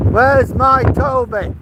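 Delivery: high, slow dog call; sing-song for the dog; talking to a dog. Real, not acted. Calling for the dog.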